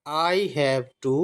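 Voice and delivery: each word read slowly and separately. Shown as a demonstration. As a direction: spell it out word by word for students. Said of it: "इसको हम have पढ़ते हैं".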